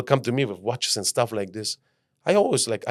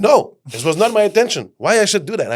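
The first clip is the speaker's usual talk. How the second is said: indignant refusal; 6 semitones higher, 5 dB louder, much faster than usual. Retold or imitated. Refusing a stranger's suggestion.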